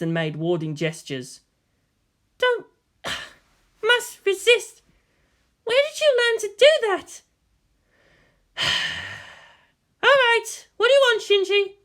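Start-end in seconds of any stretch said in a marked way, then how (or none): 2.4-11.7 high woman's voice with sighs, caving; trying not to melt and failing; woman giving in. Acted. Major resisting the boy's puppy eyes, sighing.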